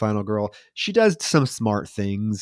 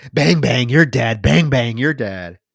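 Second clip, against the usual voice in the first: loud singsong mock taunt; taunting killer; 6 dB louder than usual. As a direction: chant it like a mocking villain.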